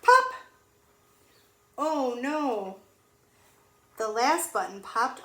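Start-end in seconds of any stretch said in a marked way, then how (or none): 0.0-0.3 loud high pop; sound effect shout. Acted. Button pops in story. 1.8-2.8 mock dismay. Button popped off.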